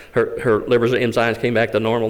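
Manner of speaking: ordinary talk, nothing marked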